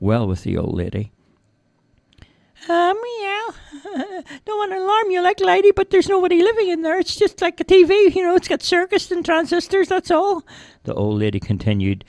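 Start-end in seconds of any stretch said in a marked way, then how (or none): 2.7-10.4 very high cartoon cat voice; chatty little cat, pitched way up; talking cat Fluffy. A narrator's reading.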